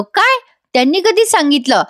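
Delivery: a sudden high startled "what?"; taken aback, asking when; surprised. A narrator's reading. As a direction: jolt up in disbelief. Husband startled by news.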